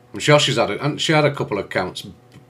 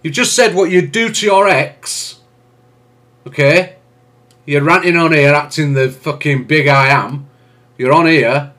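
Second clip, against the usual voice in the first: full-volume, much higher angry yelling at him; furious shout; 8 semitones higher, 11 dB louder than usual. Real, not acted. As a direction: tear into him at the top of your voice. Shouting down guest on live.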